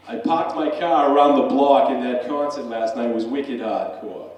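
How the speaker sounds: loud, broad Boston accent; wicked local accent. Shown as a demonstration. Speaker demonstrating his accent to the audience, broad loud Boston accent. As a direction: show off a thick Boston accent. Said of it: "that accent".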